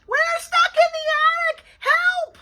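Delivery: high, full-force screech for help. A narrator's reading. Bean stuck in the attic calling the babysitter, screeching. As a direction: screech it so the whole house hears. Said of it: "took a deep breath and screeched".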